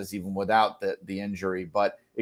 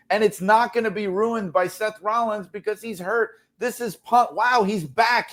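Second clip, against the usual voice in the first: excited; 6 semitones higher than usual. Retold or imitated. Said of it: "It was to create a scenario where it's like".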